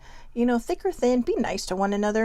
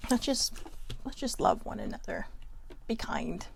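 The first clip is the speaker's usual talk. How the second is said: tender plea; 3 semitones lower, 8 dB quieter than usual. Real, not acted. Ending her sermon to viewers with a soft plea. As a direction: lower and softer, almost whispered.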